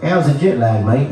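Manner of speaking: a touch of Aussie greeting; Australian accent. Retold or imitated. Australians greeting the jet-lagged American comic, light Australian accent. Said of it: "every Australian I met was like".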